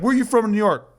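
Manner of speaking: lightly voiced stranger interrogating him; mock aggressive stranger. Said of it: "he is very aggressive".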